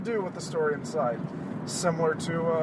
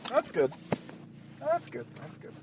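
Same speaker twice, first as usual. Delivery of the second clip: softer with each repeat, fading to nothing; fading relief; 4 semitones higher, 8 dB quieter than usual. Real, not acted. Host relieved, repeating himself softer and softer. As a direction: settle down as the struggle ends.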